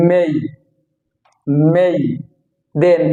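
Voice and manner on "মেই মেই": মেই with the M pressed; stressed M. Shown as a demonstration. Teacher drilling students on a stressed M, মেই with firm M. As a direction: lean on the M of মেই. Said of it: "stress করে যদি আমরা বলি".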